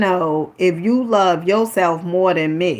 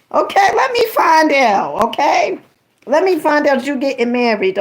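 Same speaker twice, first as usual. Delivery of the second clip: playful teasing; 6 semitones higher than usual. Real, not acted. Teasing Miss Billy.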